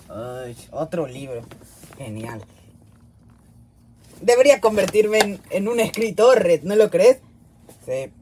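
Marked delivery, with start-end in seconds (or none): 0.1-2.4 low, soft, unenthused grumble; quiet, deflated deadpan; sarcastic resignation. Acted. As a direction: mutter it with weary sarcasm. Cellmate dreading another book.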